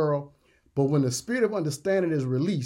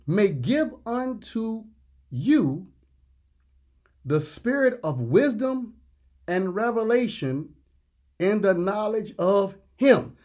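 very slow, higher-pitched reading with pauses between phrases; deliberate, raised preacher's delivery; slow scripture reading; 5 semitones higher, 3 dB louder, slower than usual. Real, not acted. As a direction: read it out phrase by phrase, slowly and emphatically. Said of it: "we're gonna read this slowly".

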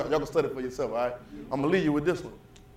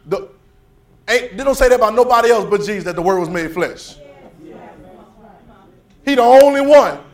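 loud, climbing sermon delivery; preaching at full force; 5 semitones higher, 12 dB louder than usual. Acted. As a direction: preach it out, rising and shouting.

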